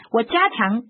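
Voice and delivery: usual speaking voice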